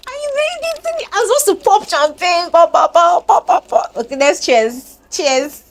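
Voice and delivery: very high, loud giddy cheering; celebrating. Real, not acted. Vlogger celebrating the year's end with fans, giddy. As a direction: pop the imaginary champagne.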